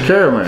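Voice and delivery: "caramel" said care-a-mel; three-syllable caramel. Shown as a demonstration. Answering co-host's question, "caramel" as care-a-mel. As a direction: open "caramel" with a "care" sound, middle syllable kept. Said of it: "people say it all kinds of ways".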